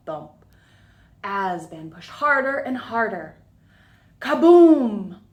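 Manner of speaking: usual speaking voice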